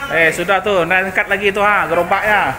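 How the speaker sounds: loud, laughing calls across the room; excited shouting. Real, not acted. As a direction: shout over to the crew, half laughing. Shouting at workers moving boards.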